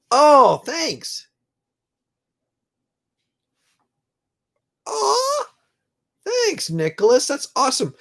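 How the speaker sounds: high-pitched, choked-up gratitude; tearful thanks. Real, not acted. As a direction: thank them while fighting back real tears. Moved by a kind comment.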